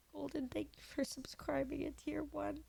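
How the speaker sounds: crying whisper to a subscriber; tearful whisper. Real, not acted. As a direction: barely get the thanks out. Crying streamer thanks a subscriber.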